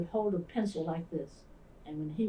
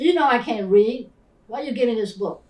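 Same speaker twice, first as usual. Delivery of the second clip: loud angry yell, higher; 5 semitones higher, 13 dB louder than usual. Retold or imitated. Boy yelling at his teacher that he cannot read, loud and angry. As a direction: throw it back at the teacher in anger. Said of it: "And he yelled at me".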